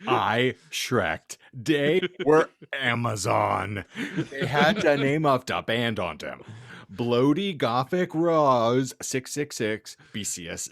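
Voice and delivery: ordinary delivery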